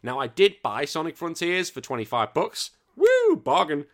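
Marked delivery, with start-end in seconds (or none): 2.9-3.8 high, loud whoop; excited cheer. Real, not acted. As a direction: cheer the bargain. Cheering a cheap purchase.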